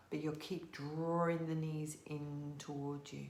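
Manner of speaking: soft, calm yoga instruction; guided yoga cue. Acted.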